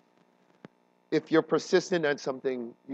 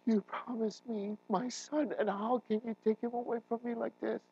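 higher, desperate plea to God; pleading prayer; 10 semitones higher, 5 dB quieter than usual. Retold or imitated. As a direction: beg God not to take your son. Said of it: "And I was praying".

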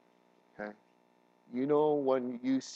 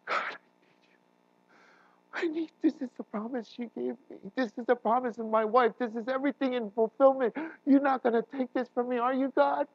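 higher, rising urgent plea; desperate prayer; 12 semitones higher than usual. Retold or imitated. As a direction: demand God keep his promise. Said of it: "Praying to God".

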